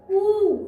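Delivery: "ऊ" held long as a loud, pure oo; long OO sound. Shown as a demonstration. Teacher showing children the long vowel, "ऊ" drawn out. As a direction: draw "ऊ" out as one long oo vowel. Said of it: "long sound बोल रहा है".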